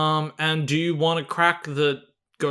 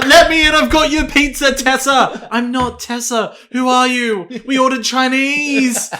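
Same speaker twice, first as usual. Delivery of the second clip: higher, louder mock pizza delivery voice; mock pizza guy skit; 6 semitones higher, 4 dB louder than usual. Pretend pizza driver at Tessa's door, higher and louder. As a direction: shout through the door, then slow confused reply.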